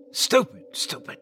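unvoiced self-scolding hiss; angry whisper of self-blame. Real, not acted. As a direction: hiss it at yourself under your breath. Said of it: "I hissed at myself".

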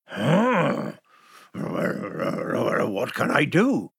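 slow, confused wolf; puzzled wolf. Acted. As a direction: let the wolf wonder slowly.

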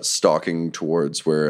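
ordinary talk

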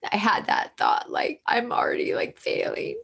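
whispering through real emotion, breath catching; fighting tears. Real, not acted. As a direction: she chokes up telling her mother's story, voice dropping to a whisper. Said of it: "I'm gonna get emotional".

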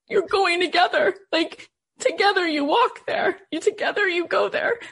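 real tears welling up while repeating the words; voice breaking. Real, not acted. Friend's comfort, retold tearfully.

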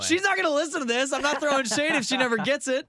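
high, loud rush of denial; mock protest. Real, not acted. Host defends himself over throwing shade at his girlfriend, high mock protest.

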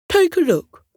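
high, coaxing voice; inviting little voice. Acted. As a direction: coax the shy one to peek. Dog invites cat to look.

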